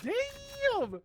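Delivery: very high, long drawn-out damn; amazed exclamation. Retold or imitated. Retelling his reaction to the bros, amazed exclamation. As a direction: stretch it out high in mock astonishment.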